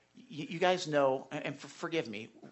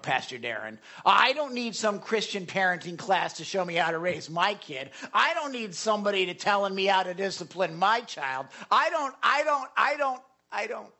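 mock indignant, building louder and higher; prideful parent; 5 semitones higher, 4 dB louder than usual. Proud parent rebuffing Pastor Darren's advice, indignant and prideful. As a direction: protest like a proud know-it-all, climbing to a repeated refusal. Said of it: "here's a common verbiage of, of pride".

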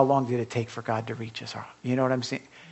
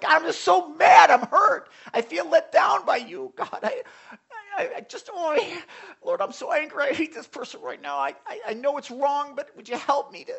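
much higher, louder hurt cry to God, breaking into a laugh; pretend angry prayer; 12 semitones higher, 4 dB louder than usual. Believer voicing raw prayer to God, higher and louder. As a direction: pour out hurt and anger at God. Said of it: "you can say things like".

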